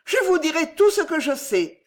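put-on high-pitched, moved old woman; eager old woman. A narrator's reading. Old woman agrees to tell Pardaillan all she knows, eager and high.

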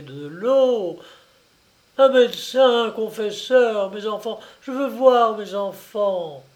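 dying mother pleading for a doctor; broken voice. Acted. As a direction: beg in broken bursts. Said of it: "d'une voix entrecoupée".

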